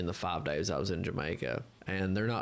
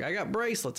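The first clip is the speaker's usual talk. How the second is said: high-pitched mock dealer's offer; imitated Rasta hustler; 10 semitones higher than usual. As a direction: make a pushy pitch in a put-on high voice. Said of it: "We had this Rasta guy come up".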